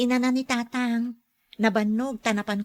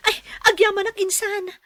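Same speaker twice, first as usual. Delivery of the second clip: tearful thanks; 9 semitones higher, 4 dB louder than usual. Acted. Cousin hears of job offer.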